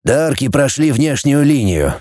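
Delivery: plain reading voice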